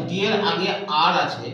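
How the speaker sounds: ordinary talk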